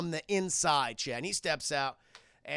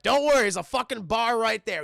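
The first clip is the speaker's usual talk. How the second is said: loud, raised-pitch reassurance; loud brush-off; 4 semitones higher, 8 dB louder than usual. Retold or imitated. Logan told to wait an hour, loud brush-off. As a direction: wave it off at full volume.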